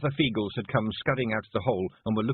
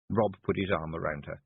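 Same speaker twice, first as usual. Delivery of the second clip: plain reading voice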